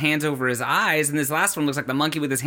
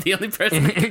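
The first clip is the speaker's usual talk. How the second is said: normal talking voice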